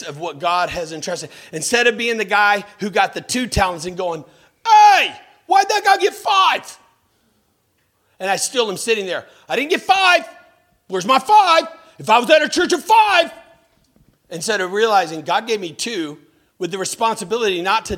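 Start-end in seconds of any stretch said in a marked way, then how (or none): 4.7-6.8 loud, high, indignant yell; the two-talent servant protesting; envious shout. Retold or imitated. 9.5-13.3 loud, high, indignant yell; the two-talent servant protesting; envious shout. Retold or imitated.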